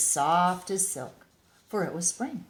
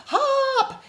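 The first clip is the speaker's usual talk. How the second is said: very high, loud, laughing shout; 10 dB louder than usual. Acted. The bear shouting at the rabbits, very high laughing yell. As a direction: squeal it out to the rabbits. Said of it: "he shouted to the rabbits".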